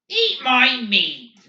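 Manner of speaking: a high, loud, slow snarl. A narrator's reading. Barkovich snarling back at McVries, high and loud. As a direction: spit it out with contempt. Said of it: "Barkovich snarled".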